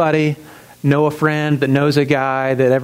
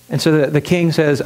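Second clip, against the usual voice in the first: ordinary delivery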